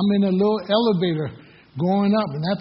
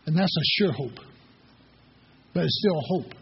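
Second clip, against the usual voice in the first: usual speaking voice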